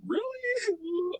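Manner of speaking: high, drawn-out awkward "really"; retold surprise. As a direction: squeak it out, taken aback. Retold taken-aback reaction to hug wish.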